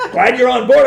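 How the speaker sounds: retold big, cheery welcome with laughter; mock hearty welcome. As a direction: boom out an over-friendly welcome. Imitates boss's repeated hearty welcome.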